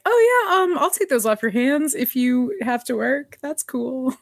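high, bright "oh yeah" that slides into a breezy offer; eager casual offer. Retold or imitated. Sound booth guy, casually offering to take the tickets off her hands. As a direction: play it cool while grabbing the tickets.

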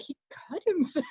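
hushed, breathless aside; whisper. Retold or imitated. Parker murmuring to the team that he got hurt, whispered. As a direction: whisper it like a secret. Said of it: "she's like".

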